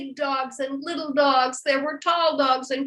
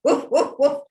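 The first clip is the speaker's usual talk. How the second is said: loud, higher imitated woofs; scare-away dog bark; 4 semitones higher, 6 dB louder than usual. Shown as a demonstration. Barking to scare that bear away, loud short woofs. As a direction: bark sharply to chase something off. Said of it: "Scare that bear away".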